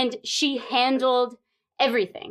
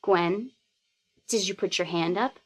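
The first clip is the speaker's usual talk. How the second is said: low, level and unhurried; very calm; 5 semitones lower than usual. Retold or imitated. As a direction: ask it as if nothing's wrong. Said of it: "She says very calmly again".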